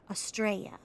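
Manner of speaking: Australia squashed to "uh-STRAY-uh", said loud; Aussie pronunciation. Shown as a demonstration. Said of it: "They say Australia really interesting. It's like".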